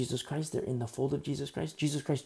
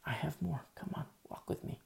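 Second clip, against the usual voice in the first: whispered, softer, drawing them close; inviting whisper; 3 semitones higher, 6 dB quieter than usual. Acted. Believer invites the new convert to walk along, whispered.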